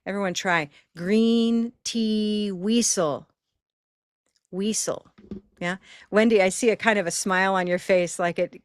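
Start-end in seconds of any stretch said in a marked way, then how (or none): 1.0-3.3 GREEN TEA WEASEL, very slow with long EE, whistle turned to WEE-sul; slow, stretched vowel demo; tried with green. Shown as a demonstration. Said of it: "Let's just try it with green". 4.5-5.1 WEASEL as WEE-sul, long EE and an s sound; tried with green. Shown as a demonstration. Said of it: "Let's just try it with green".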